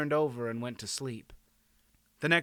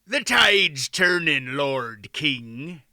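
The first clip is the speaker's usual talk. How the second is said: loud, slow, hearty captain; loud, drawn-out seaman's voice; sea captain; 6 dB louder, much slower than usual. A narrator's reading. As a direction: call it out loudly to the king. Sea captain hails the king.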